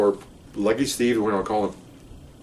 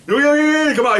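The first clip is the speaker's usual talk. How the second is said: high, rapid-fire retold yelling; high mock shouting; yelled police orders; 14 semitones higher, 4 dB louder than usual. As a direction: imitate a cop hollering commands, pitch way up. Cops yelling at suspects retold.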